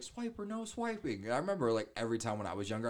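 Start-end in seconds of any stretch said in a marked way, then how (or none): 0.0-1.2 high, slow cartoon girl voice; Dora voice. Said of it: "and she's like".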